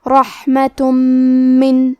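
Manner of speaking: "رحمتم من" chanted with a long nasal doubled meem; merging with ghunnah. Shown as a demonstration. Teacher demonstrates nasal merging, chanted "رحمتم من" with held meem. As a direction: hum through the joined meem in "رحمتم من". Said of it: "یہ حکم کو غنہ اور تشدید کے ساتھ".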